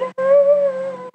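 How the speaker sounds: sharp, high surprised gasp. Retold or imitated. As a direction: gasp in shock.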